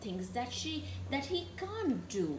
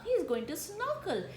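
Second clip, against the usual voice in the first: usual speaking voice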